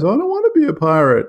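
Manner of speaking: high, loud whining complaint; whiny voice. Retold or imitated. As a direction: whine it like a spoiled kid. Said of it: "in his much-known whiny voice".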